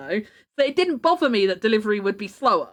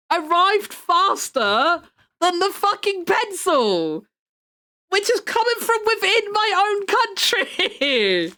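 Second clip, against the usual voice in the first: high, drawn-out incredulous rant; slow, high-pitched indignant complaint; exasperated disbelief; 6 semitones higher, slower than usual. Real, not acted. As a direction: stretch the words in whiny, comic outrage. Mock outrage over late pencil delivery.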